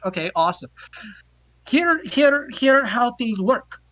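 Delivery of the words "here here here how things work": high, slow put-on Norwegian accent; mock Norwegian accent. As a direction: explain it slowly as the Norwegian woman. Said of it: "this accent is".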